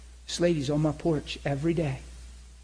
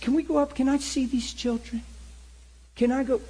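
much higher, eager and pressing; eager request; 9 semitones higher than usual. Retold or imitated. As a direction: push to go upstairs right now. Eager request to see children.

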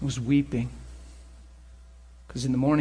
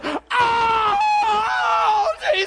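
high, long-drawn scream to Jesus; acted howl of pain, loud and stretched; pained holler; 6 dB louder, much slower than usual. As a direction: reenact yelling in agony up the steps. Acting out climbing steps in pain.

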